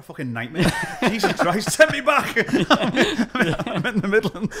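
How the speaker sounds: loud high laughing through the words; real laughter. Real, not acted. Mocking waking up in Birmingham, loud high laughter. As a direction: crack up as you say it.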